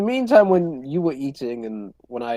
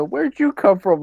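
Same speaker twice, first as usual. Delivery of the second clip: higher, louder stock video game NPC; NPC line; 9 semitones higher, 8 dB louder than usual. Retold or imitated. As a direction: deliver it like a game extra. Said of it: "Bro said that like a N P C".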